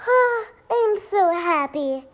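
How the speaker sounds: slow, high dreamy sigh of joy; heart's happy sigh. Retold or imitated. Heart sighing to the listener, slow and high. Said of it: "make your heart go".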